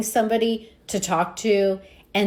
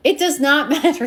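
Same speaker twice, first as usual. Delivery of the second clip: laughing insistence; 6 semitones higher, 4 dB louder than usual. Real, not acted.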